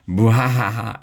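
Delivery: high hammed-up evil cackle; evil mad scientist laugh. A narrator's reading. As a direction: cackle like a cartoon villain. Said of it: "say 'boo-ha-ha' in your best evil mad scientist voice".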